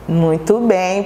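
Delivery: ordinary talk, nothing marked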